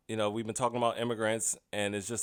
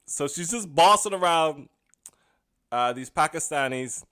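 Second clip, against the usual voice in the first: ordinary talk, nothing marked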